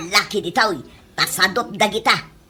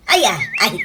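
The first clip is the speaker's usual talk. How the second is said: ordinary talk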